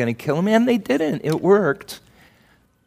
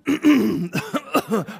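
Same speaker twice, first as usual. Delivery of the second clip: put-on cough to hide tears; fake cough; 6 semitones higher than usual. Pastor miming a man hiding tears at the movies with a fake cough. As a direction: cough to cover crying.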